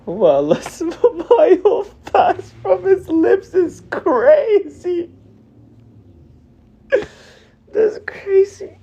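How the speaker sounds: high, tearful laugh-cry; laughing through tears. Real, not acted. Crying over the death.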